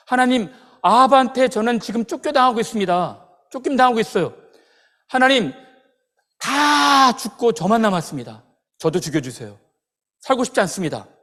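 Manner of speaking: loud, high, desperate complaint; protest to God. Retold or imitated. Elijah begging God to let him die, desperate loud protest. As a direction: cry out in despair, acting out a prophet. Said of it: "이렇게 하나님 앞에 항변하거든요".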